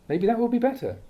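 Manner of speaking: normal talking voice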